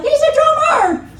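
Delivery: high, slow proud exclamation; excited parent. Pretend. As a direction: announce it like a thrilled parent. Parent excited about child's hobby.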